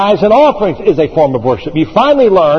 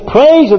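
"Praise you" leaping much higher on "Praise"; stress on praise; 11 semitones higher, 4 dB louder than usual. Shown as a demonstration. Preacher to congregation, exclaiming the word "Praise" up high. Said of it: "It says".

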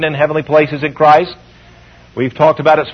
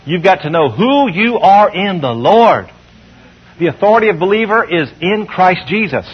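ordinary talk

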